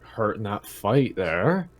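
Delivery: ordinary delivery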